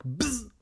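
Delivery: high buzzing zap noise; imitated electric buzz. Reader illustrates the mouse's shock, buzzing.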